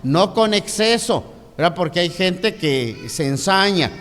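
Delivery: loud, high sermon delivery, counting out points; preacher building up to full force; preaching. Acted. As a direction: drive each point home to the congregation. Preaching three points of discipline.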